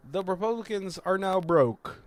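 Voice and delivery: high, projected mock newsreader; news anchor. News report closing the movie, in anchor voice. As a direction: announce it like a TV headline. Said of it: "a news where it's like".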